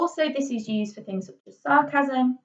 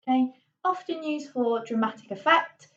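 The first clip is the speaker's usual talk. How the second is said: ordinary talk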